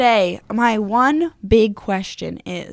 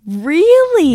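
surprised; 10 semitones higher, 8 dB louder than usual. Real, not acted. Surprised reaction to crying confession.

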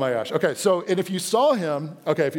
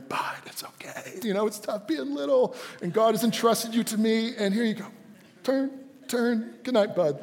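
soft, higher, tender bedtime voice; kind father; 6 semitones higher, 3 dB quieter than usual. Acted. Dad comforting his son at bedtime, gentle and hushed. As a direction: soothe a small child very softly, nearly whispering at first. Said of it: "because I preached on kindness".

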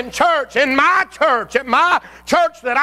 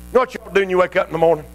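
ordinary talk, nothing marked

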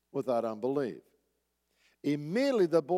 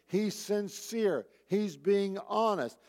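normal talking voice

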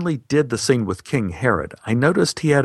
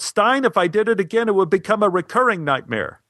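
high-pitched mock yell; retold shout without extra volume; 8 semitones higher than usual. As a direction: call a protest out to the director. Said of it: "I yelled".

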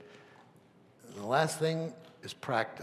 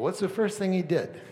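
normal talking voice

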